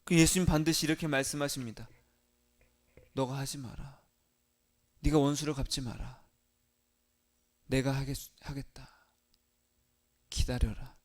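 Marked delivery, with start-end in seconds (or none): 3.1-11.0 low, soft, measured voice of authority; quiet divine command. Acted. Jesus telling a believer not to take revenge, low and hushed. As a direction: restrain the listener quietly, leaving pauses.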